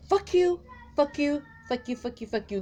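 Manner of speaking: a burst of rage that runs out of steam; repeated angry curse, fading. Pretend. Reader chanting the woman's fury at him, shouted then fading. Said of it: "channeling her energy".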